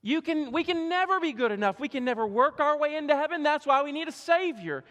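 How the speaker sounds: high, loud pleading from the pulpit; impassioned preaching. Acted. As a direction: press it home with fervour. Preaching climax.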